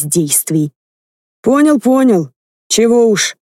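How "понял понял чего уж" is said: put-on higher, grudging old man grumble; grumbling grandpa. A narrator's reading. Grandpa grumbling back at Velior, reluctant grumble. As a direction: grumble it, giving in. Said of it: "проворчал дед".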